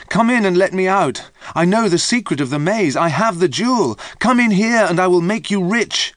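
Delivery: high, loud desperate calling through a gate. A narrator's reading. Trapped traveller calls to people outside, loud desperate pleading. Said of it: "The traveller called through the gate".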